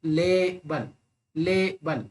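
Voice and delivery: Label in two beats, long LAY then falling bul; teacher's slow two-syllable demo of label; stress on first syllable. Shown as a demonstration. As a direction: stretch ले in label and drop बल. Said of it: "पहला syllable हमेशा stressed होना चाहिए".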